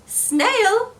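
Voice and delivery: calling out. Acted. Frog calling the snail from afar, high call.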